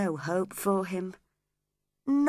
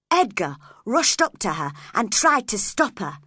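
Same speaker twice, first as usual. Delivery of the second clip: ordinary delivery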